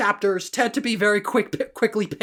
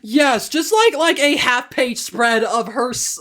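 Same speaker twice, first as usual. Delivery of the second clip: usual speaking voice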